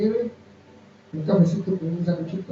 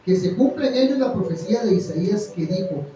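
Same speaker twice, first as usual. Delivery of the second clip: scripture reading; 2 semitones higher, 4 dB louder than usual. Acted. Reads scripture with force.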